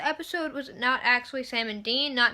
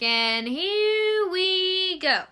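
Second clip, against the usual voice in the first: slow, higher, drawn out; mock dread, much slower; knowing drawn-out here we go; 6 semitones higher, much slower than usual. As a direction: savor the coming trouble. Anticipating trouble.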